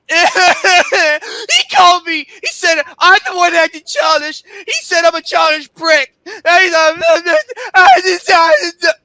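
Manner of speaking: high, wailing indignation; blubbering, over-the-top protest; mock crying outrage. Real, not acted. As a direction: whine it loudly through scornful laughs. Mocks the accusation of being childish.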